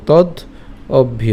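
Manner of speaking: "তদ", clear pause, then "অভ্য"; teacher breaking "তদ অভ্য" in two; split pronunciation. Shown as a demonstration. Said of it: "উচ্চারণ করার সময় এভাবে করবো". ("তদ অভ্য" থেমে ভেঙে উচ্চারণ)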